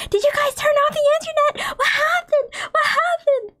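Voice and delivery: high, panicked questions to parents; frantic asking. Retold or imitated. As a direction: frantically ask what happened, voice breaking. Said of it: "running around frantically asking my parents".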